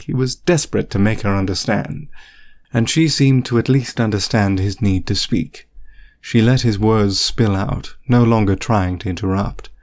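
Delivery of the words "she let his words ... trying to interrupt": ordinary delivery, nothing marked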